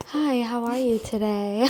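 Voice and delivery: soft, gentle spa receptionist voice; soothing esthetician greeting. Shown as a demonstration. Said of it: "I have a spa voice".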